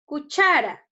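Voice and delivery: "Cuchara" loud, with cha punched; loud teacherly emphasis on cha in "Cuchara"; stress on CHA. Shown as a demonstration. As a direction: say "Cuchara" as cu-CHA-ra, middle syllable strong.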